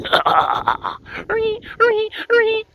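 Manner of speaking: high, loud imitated horror-film stabs; horror screech. Ending a mock horror trailer, high screeching.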